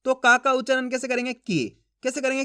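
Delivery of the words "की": brief, low falling "की"; "की" kept short as a reading model; short i sound. Shown as a demonstration. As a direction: say "की" with a short vowel, dropping in pitch. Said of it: "इसके उच्चारण में कम समय लगेगा".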